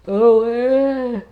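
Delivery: words stretched by a yawn. Real, not acted. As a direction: say it mid-yawn. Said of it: "Sorry for yawning again".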